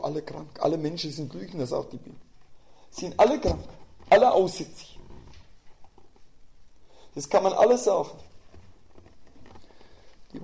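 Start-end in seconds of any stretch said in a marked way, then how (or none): 2.9-8.1 fervent preaching. Acted. Preacher confronting the congregation's pride, fervently. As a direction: drive it home from the pulpit.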